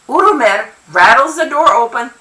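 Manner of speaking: plain reading voice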